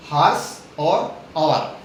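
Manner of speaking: Horse and आवर each said slowly and clearly; slow clear demo. Shown as a demonstration. Teacher presenting the example words to students, slow and clear. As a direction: spell the two words out slowly for the class. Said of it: "दो word हैं".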